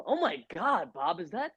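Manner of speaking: lightly voiced shocked question, rising high; half-joking disbelief; a mild mock of gawking at Bob; mock surprise. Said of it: "I look up at him".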